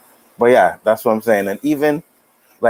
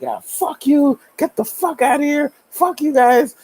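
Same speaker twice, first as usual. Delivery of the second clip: put-on kid cursing at cops. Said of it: "he's just like".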